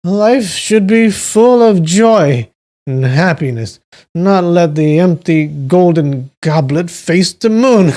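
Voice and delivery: put-on high, slow drunken proclamation with a laugh; Duan Yu, drunk revelry. A narrator's reading. Drunk man urging his brother to finish the cups, revelling. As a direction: carouse loudly, dragging out the words. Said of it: "Duan Yu was completely drunk".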